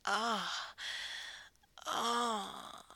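long, open sighing moan; breathy moan. Pretend. Dominant enjoying the scene, breathy drawn-out moan. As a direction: moan mid-sentence. Pleasure in roleplay.

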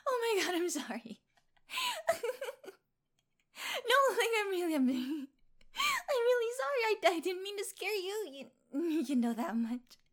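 high-pitched, laughing apology; words bubbling up between giggles; giggly apology. Acted. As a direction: apologise while trying to stop giggling. Apologising amid giggles after prank.

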